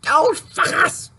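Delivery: high, slow, shrieking furious impression; pissed-off Claudia. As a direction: shriek it like an enraged friend. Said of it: "Claudia, when she's pissed off".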